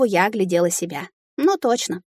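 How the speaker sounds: ordinary delivery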